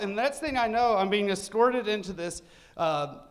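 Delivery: ordinary talk, nothing marked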